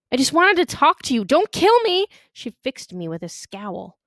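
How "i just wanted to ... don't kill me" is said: high-pitched, panicky plea; frightened pleading. A narrator's reading. Boy to girl with a knife, pleading in fear. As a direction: plead for your life, voice climbing. Boy begs for his life.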